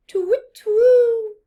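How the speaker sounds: loud high owl hoot. Acted. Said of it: "hooted the owl".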